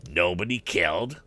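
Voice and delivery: usual speaking voice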